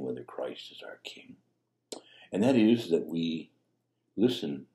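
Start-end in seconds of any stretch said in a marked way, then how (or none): 0.0-1.4 emphatic whisper. Real, not acted. Key point in a hush.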